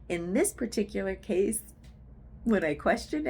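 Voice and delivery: usual speaking voice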